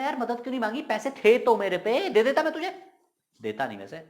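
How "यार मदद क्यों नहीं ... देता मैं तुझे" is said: high-pitched, pleading reproach; complaining friend. Acted. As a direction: ask a pal why he never asked.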